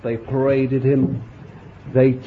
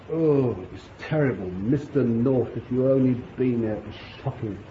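whining complaint; 2 semitones lower, 7 dB quieter than usual. Retold or imitated. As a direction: moan about being mistreated. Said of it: "He didn't say".

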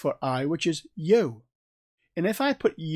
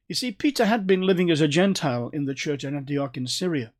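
usual speaking voice

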